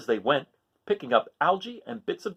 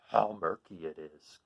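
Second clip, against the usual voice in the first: complaining coral; 8 semitones lower, 5 dB quieter, slower than usual. Acted. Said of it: "complained the coral".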